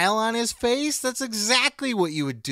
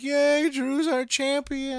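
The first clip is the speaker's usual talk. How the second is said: mock fan cheer; 8 semitones higher, slower than usual. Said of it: "everybody's like".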